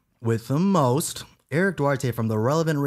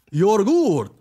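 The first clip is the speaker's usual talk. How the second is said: "You're good" swooped high and drawn out; hammed-up vampire count; mock Dracula; 5 semitones higher, 3 dB louder than usual. Dracula answering the confused dude, swooping high. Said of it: "this is Dracula".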